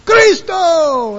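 high, loud shout; crowd's yelled response. Retold or imitated. Preacher imitating the crowd's answer, high loud shout. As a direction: shout it back like a roused crowd. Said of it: "everybody will go".

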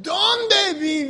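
high, loud follow-up call; yelled challenge. Retold or imitated. Preacher retelling his challenge to the Mexican church, yelled. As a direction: fire the question back at the crowd. Retold follow-up yell to congregation.

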